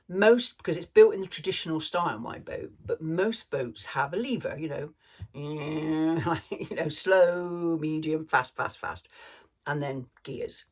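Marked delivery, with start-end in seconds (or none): 5.2-6.2 long level droning hum; imitated engine hum. Boater showing how a speed lever works, droning hum. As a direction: hum like a motor running steadily.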